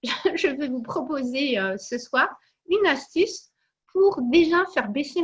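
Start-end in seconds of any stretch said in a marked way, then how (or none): none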